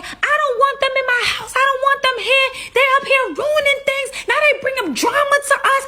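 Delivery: ranting to throw them out of the house; mock shrieking rant. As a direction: girlfriend shrieking that they must leave. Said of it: "so she's saying".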